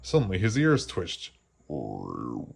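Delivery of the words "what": much lower, hushed "What"; hushed suspense. Acted. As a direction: drop low as if hearing something. Sudden strange sound.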